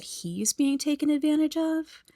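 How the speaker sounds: high-pitched disbelief; scandalized, questioning the very idea; incredulous. Real, not acted. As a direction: lift the whole phrase like an outraged question. Disbelief at Sarah's claim.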